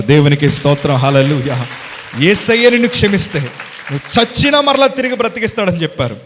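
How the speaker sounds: loud, high preacher's proclamation; shouted praise. Acted. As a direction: hammer the promise home with praise. (బిగ్గరగా ప్రసంగ ధ్వనితో ప్రకటన)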